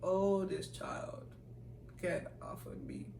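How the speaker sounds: slow, hushed aside of weary annoyance; exasperated inner thought. Retold or imitated. Junior silently reacting to the senior, muted exasperation. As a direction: mutter it slowly to yourself, fed up. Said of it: "and in my head".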